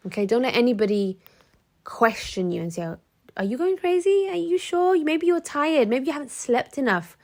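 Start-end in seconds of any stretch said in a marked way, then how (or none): 3.4-7.2 much higher, sing-song condescending voice; mocking gaslighter. Gaslighting partner talking down to his girlfriend, high mock concern. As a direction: sneer sweetly like a manipulator.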